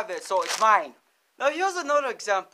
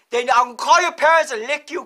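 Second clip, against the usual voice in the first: mock threat; 3 semitones higher, 8 dB louder, faster than usual. Threatening student jokingly.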